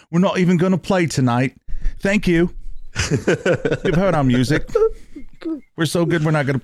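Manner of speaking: put-on a smug English rock star, light English accent. Host imitating arrogant band in English accent. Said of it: "I don't know why I have an English accent".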